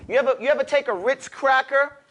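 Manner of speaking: ordinary talk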